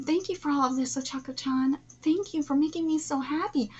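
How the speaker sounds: ordinary delivery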